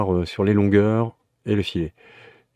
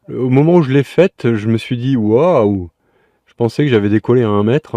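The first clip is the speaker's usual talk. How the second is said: excited; 3 semitones higher, 7 dB louder than usual. Real, not acted. Recalls big jump.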